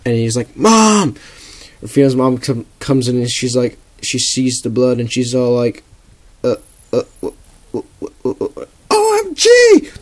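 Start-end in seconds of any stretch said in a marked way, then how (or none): 0.6-1.2 high shout for mom; calling out. Acted. Boy coughing blood yells for his mom, high shout. 6.4-8.7 stuttering, breathy gasps of shock; shocked stammer. Acted. Mom sees the blood and stammers in shock. 8.9-9.9 very high, loud shriek; shrieking mom. Acted. Mom sees her son's blood, shrieks very high.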